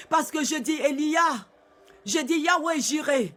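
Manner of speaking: normal talking voice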